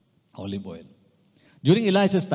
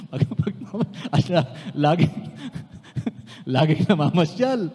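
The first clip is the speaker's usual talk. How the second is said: laughing; 5 semitones higher, 5 dB louder than usual. Real, not acted.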